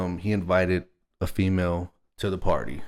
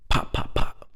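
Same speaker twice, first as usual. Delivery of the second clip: imitated gunshots. Mimicking gunshots heard at party.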